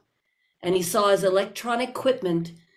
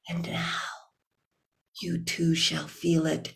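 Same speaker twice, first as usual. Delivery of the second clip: menacing hush; 5 semitones lower, 7 dB quieter than usual. Acted. Demon about to drop keeper.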